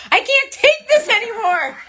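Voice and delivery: high, drawn-out mock despair; exasperated outburst. Wife fed up with drooling husband, exasperated outburst. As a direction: wail it slowly, at the end of your rope. Retold breaking point with snoring husband.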